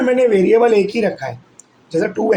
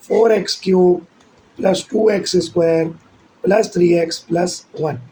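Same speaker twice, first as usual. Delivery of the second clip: ordinary talk, nothing marked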